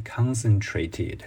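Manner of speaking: "concentrated" said slowly with the stress on the first syllable, "CONcentrated"; stress on CON. Shown as a demonstration. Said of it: "它的重音又回到了动词时候的那个重音". (重音在第一个音节的"concentrated")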